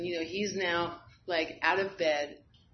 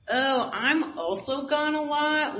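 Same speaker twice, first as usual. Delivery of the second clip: sudden realization; 8 semitones higher, 5 dB louder, slower than usual. Retold or imitated. Sudden realization in step work.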